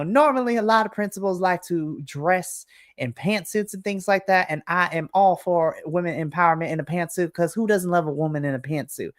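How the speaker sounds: a syrupy Southern welcome, honey and darling; Southern lady. Acted. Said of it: "This is very Southern, like, lady".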